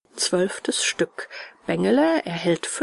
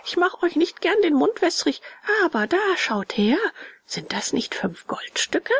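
put-on boastful puppet boy; 9 semitones higher than usual. A narrator's reading. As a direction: show off the money, starting high. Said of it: "schüttelte stolz sein Geld". (prahlerischer Puppenjunge, anfangs hoch)

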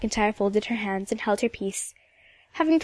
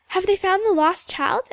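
higher-pitched, mildly eager asking; eager question; 8 semitones higher, 4 dB louder than usual. Acted. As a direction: ask keenly, wanting news. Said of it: "asked Ilse eagerly".

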